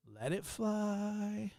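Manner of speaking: slow, soft and deliberate; slow quiet let it fly; drawn-out resolve. Real, not acted. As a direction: stretch it out, savouring the decision. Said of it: "I'm just gonna let it fly".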